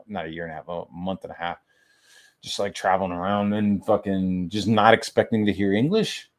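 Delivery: usual speaking voice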